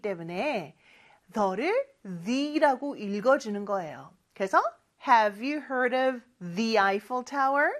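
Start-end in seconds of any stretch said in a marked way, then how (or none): 5.1-7.7 "the" said "thee" before "Eiffel", slow and rising; slow model with "thee Eiffel"; careful pronunciation. Shown as a demonstration. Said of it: "이렇게 발음해 주셔야 돼요". ("the"를 "디"로 읽는 천천히 올리는 시범)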